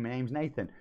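usual speaking voice

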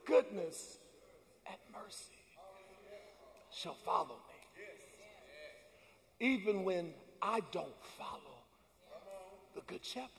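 hushed recitation. Real, not acted. Preacher softly reciting the psalm to the church. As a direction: whisper the scripture reverently.

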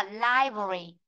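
"library" as "lai-vri", middle syllable squeezed; teacher's model "library", compact ending; "library" without a separate "bơ-rơ"; correct pronunciation. Shown as a demonstration. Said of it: "đừng có đọc thế".

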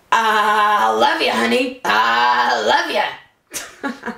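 drawn-out, droning mock declaration of love; mocking husband imitation. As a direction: imitate a plodding husband in bed, very slowly. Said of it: "it was like he was fixing a carburetor".

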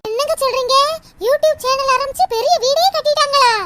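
acted wide-eyed surprise, voice lifting; astonished disbelief. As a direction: react amazed to unbelievable good news. Wife amazed at friend's YouTube success.

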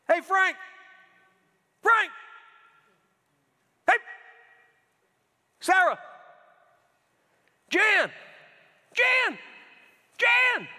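high, loud calling of names; God calling names. Retold or imitated. The Lord calling to distracted people, loud repeated calling. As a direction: call each name out like a father in the yard. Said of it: "the Lord's saying".

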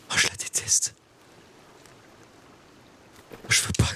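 whispered loathing, almost no voice; whispered sulk. Real, not acted. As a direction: grumble it under your breath. Sulking over the escaped prey.

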